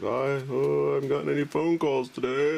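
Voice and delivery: high cartoon voice, mildly let down; puzzled Andrew Jackson. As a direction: wonder aloud in a silly high voice. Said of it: "And he's like".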